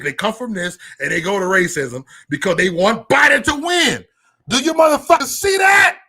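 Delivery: loud, high ranting shout; angry shout. Real, not acted. Shouting at viewers about the election, furious. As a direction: yell it out at the chat.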